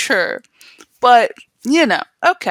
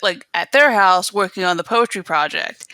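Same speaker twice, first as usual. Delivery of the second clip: usual speaking voice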